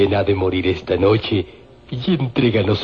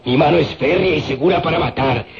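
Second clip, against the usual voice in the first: higher and fast, full of swagger; proud boast; 7 semitones higher, faster than usual. Acted. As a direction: brag about your deadly hand.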